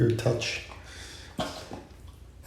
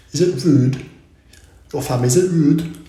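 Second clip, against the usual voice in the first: playful loud; 4 semitones higher, 5 dB louder than usual. Real, not acted. Playful self-check, loud.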